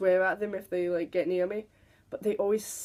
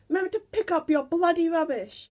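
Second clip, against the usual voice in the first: put-on higher scolding old woman; angry old lady; 8 semitones higher, 3 dB louder than usual. Angry old lady scolds bus passengers about rubbish, higher. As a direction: snap it like a cross old lady.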